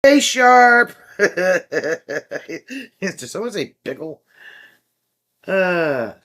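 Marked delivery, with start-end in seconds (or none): 0.0-0.9 loud, much higher call across the room. Real, not acted. Streamer greets a viewer joining chat, loud call. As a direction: hail a friend who just arrived.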